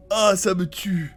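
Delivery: ordinary talk, nothing marked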